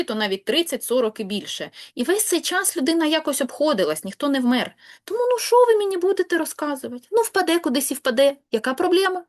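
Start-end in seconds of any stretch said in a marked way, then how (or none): 5.0-9.2 higher, louder, fast careless whine; mock dismissive pupil. Teacher mimics careless pupils shrugging off stress rules. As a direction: brush it off like a lazy student.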